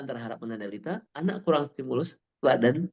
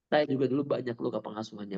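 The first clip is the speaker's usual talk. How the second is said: ordinary talk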